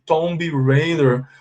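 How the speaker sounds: Tomb Raider with a B, slowly; Tomb Raider with B voiced; wrong pronunciation. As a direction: mimic the careful wrong tom-b. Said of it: "falam assim".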